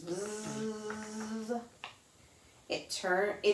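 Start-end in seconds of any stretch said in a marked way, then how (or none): 0.0-1.6 soft buzzing bee. Retold or imitated. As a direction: buzz it quietly like a bee. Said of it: "the bees in the flowers".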